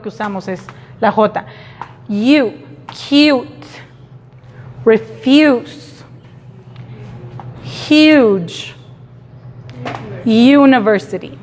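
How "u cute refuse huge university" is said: "cute" "refuse" "huge" "university" con yu; yu sound demo. Shown as a demonstration. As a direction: marca el sonido yu. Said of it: "cuando el sonido es u". (demostración del sonido yu)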